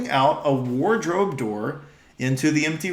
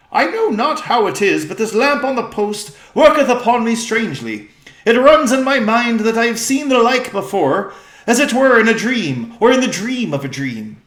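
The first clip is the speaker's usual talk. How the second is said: higher, louder stately king; regal old-fashioned speech; 9 semitones higher, 8 dB louder than usual. Acted. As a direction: declaim like a king recalling a dream. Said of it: "their voice has changed".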